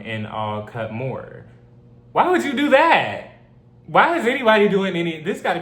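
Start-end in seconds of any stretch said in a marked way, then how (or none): 2.1-5.6 high, loud incredulous outburst; can't believe what she's reading, cry it out; shocked why, pitched way up and louder; outraged disbelief. Real, not acted.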